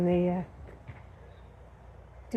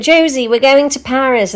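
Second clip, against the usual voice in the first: imitating excited girls, higher and louder; breezy nonchalance; 6 semitones higher, 8 dB louder than usual. Two American girls announce a Paris trip to their host, breezy. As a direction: toss it out high and loud, like a trip round the corner. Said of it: "as if it was, you know, five minutes around the corner".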